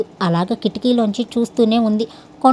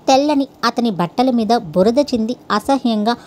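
plain reading voice

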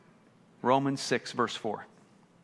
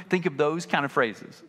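ordinary delivery

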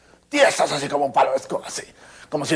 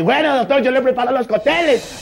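own voice, eager; 12 semitones higher, 6 dB louder than usual. Retold or imitated. Imita su propia voz coqueta.

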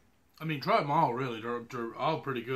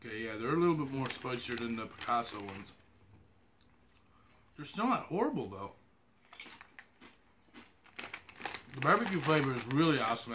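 eating chips while speaking; talking while chewing; faster than usual. Real, not acted. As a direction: mouth partly full of chips. Seen 0: Takes chips from bag, puts one in mouth mid-speech.

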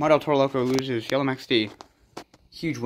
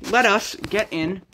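excited sports-announcer voice, a bit higher and louder; hyped announcer; 3 semitones higher, 3 dB louder than usual. Acted. Kid presenting toy monster truck standings, announcer style.

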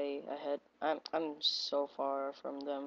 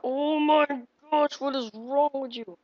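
loud, high cry of disbelief; exasperated gamer yell; exasperated outburst; 9 semitones higher, 7 dB louder than usual. Real, not acted. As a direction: yell it at the game, pitched way up. Outburst at the game.

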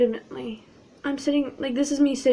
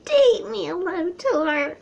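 high, loud, flirty caller; Laura impression; 8 semitones higher, 4 dB louder, much slower than usual. Mocking a friend courting Lotor, exaggerated high voice. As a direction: sing out a high-pitched mock come-on. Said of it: "Laura's like".

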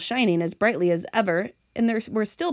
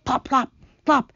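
higher, popping plops; squelching noises; 5 semitones higher, 3 dB louder than usual. A narrator's reading. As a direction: voice each plop as a sound effect. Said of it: "horrible squelching noises".

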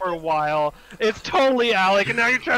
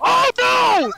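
panicked oh no; 12 semitones higher, 6 dB louder than usual. Real, not acted. Teammate about to die.

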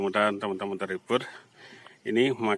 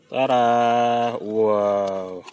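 ta-da reveal; 8 dB louder, much slower than usual. Real, not acted. Revealing the food from the box.

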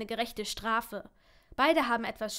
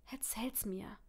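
very soft, near-whispered plea; hushed, tender invitation; gentle coaxing; 9 dB quieter, slower than usual. Acted. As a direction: coax them quietly to open up. Partner coaxes her to confide.

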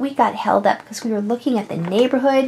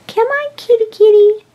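high, louder kitty call; calling a cat; 8 semitones higher, 5 dB louder than usual. Pretend. Owner calls the kitty inside, high pet-calling voice. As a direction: coax the cat over in a high voice.

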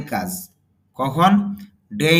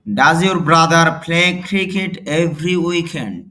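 very slow, louder, every word spelled out; slow model reading; 3 semitones higher, 6 dB louder, much slower than usual. Shown as a demonstration. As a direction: dictate it word by word for learners. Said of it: "teacher models English sentence slowly". (ধীরে ধীরে জোরে পড়া)

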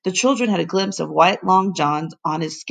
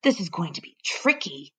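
muttering to himself; 8 dB quieter than usual. Acted. Professor struggling on the saddle.

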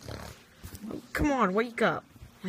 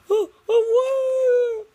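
startled shout; 6 dB louder than usual. Pretend. Puppet falling.

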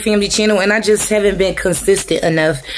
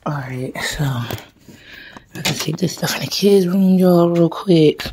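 whisper; slower than usual. Real, not acted. Mom whispering to viewers in the kids' room, hushed whisper. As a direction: breathe it out under your breath.

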